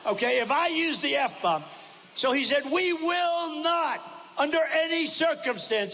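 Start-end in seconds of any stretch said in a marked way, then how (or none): 2.6-5.9 high, very slow, shouted outraged mimicry; imitating Fox refusing on live TV; mock furious Vicente Fox. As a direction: bellow it like a man going crazy in his chair. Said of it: "This is the way he said".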